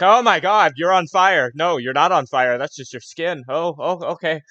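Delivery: high, loud, flustered gasp of alarm; panic, then catch yourself; startled human. Acted. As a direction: stranger startled by a fire genasi, very loud and high. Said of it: "is just staring like".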